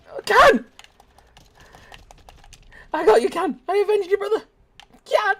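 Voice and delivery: high, loud hammed-up wailing for a lost friend; mock grief wail. Streamer wailing for fallen teammate Can, mock grief wail. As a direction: cry out over a fallen comrade, overdone.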